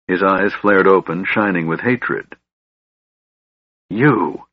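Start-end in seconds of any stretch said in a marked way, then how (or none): 3.9-4.5 mildly snarled accusation. A narrator's reading. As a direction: spit out the accusation, understated. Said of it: "Lorak snarled".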